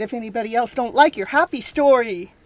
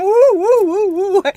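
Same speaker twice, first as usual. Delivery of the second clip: high, loud whooping; excited cheer; 7 semitones higher, 9 dB louder, slower than usual. Real, not acted. Host cheering viewers' laughter, loud and high. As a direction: whoop with joy.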